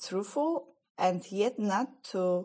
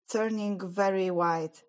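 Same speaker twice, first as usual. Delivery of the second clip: plain reading voice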